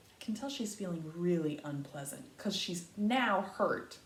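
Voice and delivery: ordinary delivery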